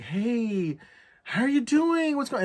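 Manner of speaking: high-pitched mock drunk friendliness; tipsy greeting. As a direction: imitate a tipsy woman greeting someone. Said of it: "a little tipsy, a little drunk".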